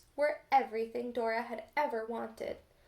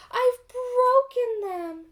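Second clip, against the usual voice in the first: much higher, much louder cry of dismay; alarmed cry; 11 semitones higher, 9 dB louder, slower than usual. A narrator's reading. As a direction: cry out as if the eggs just broke. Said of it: "cried Dora".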